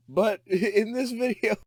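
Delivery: giggling through the words; can barely get it out for laughing. Real, not acted. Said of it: "I can't stop laughing".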